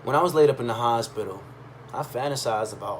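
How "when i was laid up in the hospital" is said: usual speaking voice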